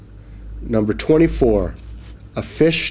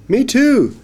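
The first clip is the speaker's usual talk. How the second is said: high loud little fish; 14 semitones higher, 5 dB louder than usual. Acted. Fish agreeing with the boy, high and bright. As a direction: pipe up brightly, a small fish. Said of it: "said the fish".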